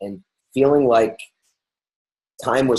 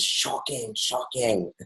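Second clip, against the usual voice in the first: mock shock; 8 dB quieter, slower than usual. Mock shock about pivoting topic.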